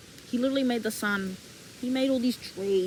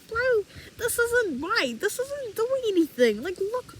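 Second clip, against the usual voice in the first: mock frustration; 8 semitones higher, slower than usual. Frustrated gardener sees no growth.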